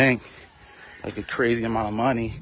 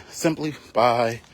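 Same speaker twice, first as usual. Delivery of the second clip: ordinary delivery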